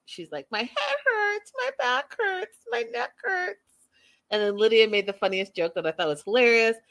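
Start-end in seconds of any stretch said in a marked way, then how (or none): 0.5-3.6 high, slow, whimpering complaint; mock whining. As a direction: whimper it like a pitiful, needy patient. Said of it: "she's whining some more".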